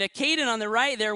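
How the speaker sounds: usual speaking voice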